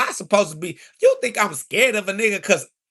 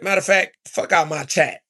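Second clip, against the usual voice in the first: ordinary talk, nothing marked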